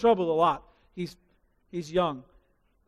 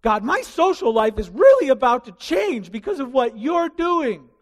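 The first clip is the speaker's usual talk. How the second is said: higher, louder complaining whine; whiny sinner voice; 5 semitones higher, 7 dB louder than usual. Retold or imitated. Unrepentant sinner complaining to God, louder whiny protest. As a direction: sound put out and sorry for yourself. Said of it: "They're gonna be mad at me".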